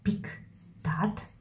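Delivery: whispered dictation while writing. Shown as a demonstration. Teacher writing on the board, whispered aloud. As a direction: breathe the words out softly as you write. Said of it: "teacher whispers the word".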